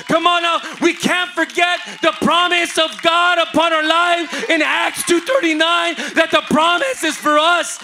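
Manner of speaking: loud, high preacher's call; full-throated altar call; preaching at full force. Real, not acted. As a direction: rouse the church to shout.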